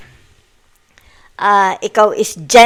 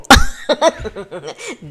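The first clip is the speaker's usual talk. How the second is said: laugh. Real, not acted. Laughs again after the point.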